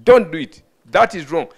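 loud, firm command; acting out a stern inner warning; voice of conscience. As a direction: lay down each short warning forcefully. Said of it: "your conscience that is saying".

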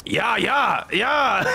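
high, barked German-style "ja"; mock angry German. Replicating the angry language, mock angry German. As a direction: imitate an angry German, hammed up. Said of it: "Germans just sound angry all the time".

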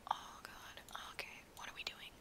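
breathy whisper to herself; hushed self-talk. Real, not acted. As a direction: whisper it under your breath. Muttering to self in VR.

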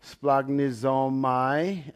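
Splagnizomai sounded out very slowly; hesitant slow try at a Greek word; careful Greek word. Shown as a demonstration. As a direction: spell out Splagnizomai syllable by syllable, unsure. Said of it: "I am going to slaughter it".

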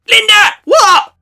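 loud high shout of the name; calling out, snapped reply. Acted. Water bottle yelling at Linda in kitchen, high loud call. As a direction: yell to get Linda's attention.

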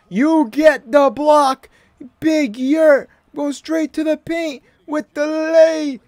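high, slow hype call; excited play-by-play. Real, not acted. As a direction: shout the block like an announcer. Blocks and scores, hyping up.

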